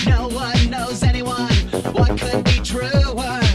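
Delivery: normal talking voice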